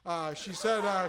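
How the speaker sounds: ordinary delivery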